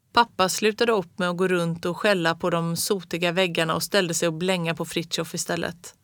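ordinary delivery

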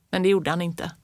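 usual speaking voice

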